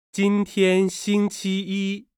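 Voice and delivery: 今天星期一 read slowly, high, and syllable by syllable; slow tone model. Shown as a demonstration. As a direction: spell out every tone for learners. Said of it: "注意韵母、声调的读法和轻重音".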